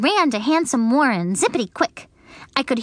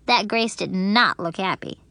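plain reading voice

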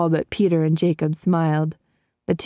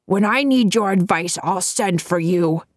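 testy. A narrator's reading. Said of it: "he said testily".

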